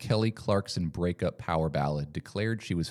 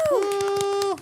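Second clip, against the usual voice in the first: shrieked, very high woo; high squealing cheer; 4 dB louder than usual. Acted. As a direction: cheer like a fake crowd. Fake crowd cheer.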